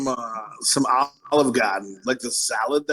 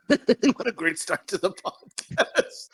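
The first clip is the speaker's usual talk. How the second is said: laughing; 4 semitones higher, much slower than usual. Real, not acted. Laughing at forgetting topic.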